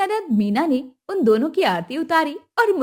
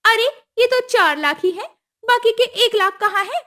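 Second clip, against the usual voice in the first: higher, pushy demand; demanding mother-in-law; 10 semitones higher, 4 dB louder than usual. Acted. Kusum demanding the dowry at the wedding, high and pushy. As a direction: ask for the money like it's owed.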